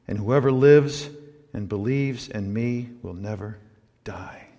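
slow, hushed, reverent; solemn scripture reading. Acted. Preacher voicing Jesus to Martha, slow and hushed. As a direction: let each phrase land softly.

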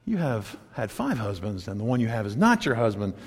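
low, soft quoted words; quiet revelation. Retold or imitated. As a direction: say it quietly and knowingly. Voicing Jesus confronting the woman.

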